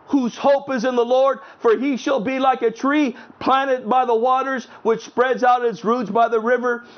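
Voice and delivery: high, fervent pulpit reading of Jeremiah; fervent preaching. Acted. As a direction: proclaim it to the congregation. Preaching and reading scripture aloud.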